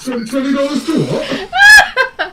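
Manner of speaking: shouting through laughter, high; laughing. Real, not acted. Teasing a friend, laughing loud. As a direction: laugh out the question in disbelief.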